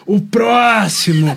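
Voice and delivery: slow, drawn-out loud call of the next patient; Bruna calling next. Retold or imitated. Recalling a tired colleague at the clinic door, slow loud call. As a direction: stretch it out like a worn-out doctor at the door. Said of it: "E eu tava igual a Bruna Sufichinha".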